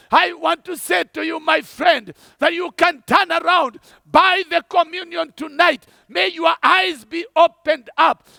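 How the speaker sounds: ordinary talk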